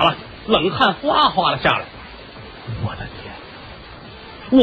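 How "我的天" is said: slow, breathless whispered gasp; stunned gasp. Acted. Man sees the kiln collapse behind him, whispered gasp. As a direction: gasp in shock at a near miss.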